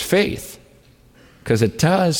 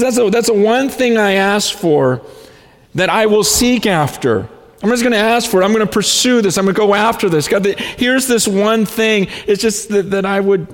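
fervent prayer; 11 semitones higher, 5 dB louder than usual. Retold or imitated.

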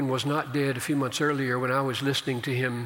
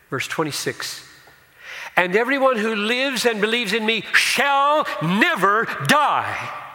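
preaching at full force; 6 semitones higher, 6 dB louder than usual. Acted. Preacher proclaiming Jesus' words to the congregation, loud. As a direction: thunder the promise out to the church.

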